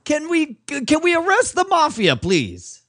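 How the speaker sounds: high, exasperated plea. Acted. Cop pleading with bickering partners, high exasperated voice. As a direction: beg them to focus.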